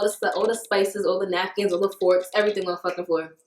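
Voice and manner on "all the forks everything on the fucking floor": usual speaking voice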